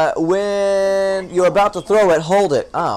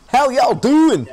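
slow, higher folksy drawl; that guy's voice; 6 semitones higher, 4 dB louder, slower than usual. Acted. Streamer greeting chat in the fisherman's voice, slow folksy drawl. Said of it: "Now I wanna talk like that guy".

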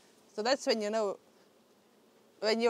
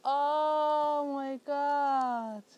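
very slow, drawn-out amazement, higher; amazed; 4 semitones higher, much slower than usual. Real, not acted. Beekeeper watching a new queen bee emerge, amazed. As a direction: stretch every word out in real awe.

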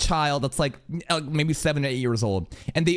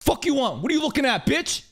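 higher, hammed-up shouted challenge; mock yell at staring kid; 10 semitones higher than usual. Retelling a bus stare-down with a kid, a mock shout. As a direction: bark it at a kid who won't stop staring. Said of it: "I'm like".

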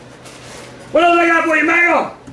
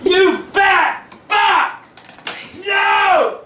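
higher-pitched outraged holler; furious yell; 3 semitones higher than usual. Real, not acted. Dad to son who broke his chair, furious yell. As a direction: explode at the culprit.

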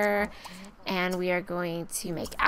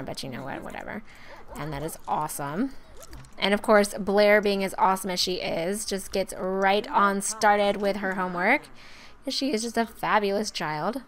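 ordinary talk, nothing marked

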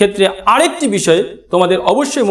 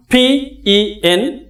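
slow letter by letter; spelling out; 2 semitones higher, much slower than usual. Shown as a demonstration. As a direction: spell "পি ই এন" slowly.

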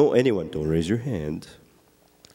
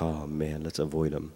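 mock aside; 8 semitones lower, 9 dB quieter than usual. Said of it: "You're like".

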